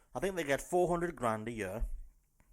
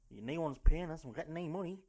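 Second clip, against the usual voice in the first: ordinary talk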